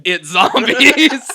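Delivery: long, drawn-out high yell; panicked mock shout. Storyteller voicing gang members revealing zombies, hammed-up shout. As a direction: stretch it into a huge hammed-up scream. Retold panicked cry, repeated loudly.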